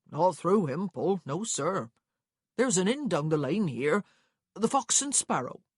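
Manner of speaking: chatty clerk giving directions; helpful country clerk. Acted. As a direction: point travellers to the local inn. Narrator voices the clerk.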